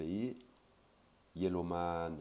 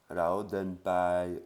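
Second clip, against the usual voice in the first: "Lao den pai" very slow and louder; slow loud demo; 7 dB louder, much slower than usual. Shown as a demonstration. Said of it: "on y va à pied".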